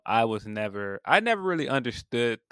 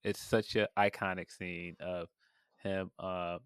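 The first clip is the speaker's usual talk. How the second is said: ordinary delivery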